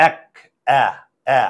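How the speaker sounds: এক said "æk", then the bare vowel "æ" twice; এ opened wide to "æ", spaced out; open-jaw æ. Shown as a demonstration. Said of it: "চোয়াল যদি খুলে এর উচ্চারণ এ হয়ে যায়".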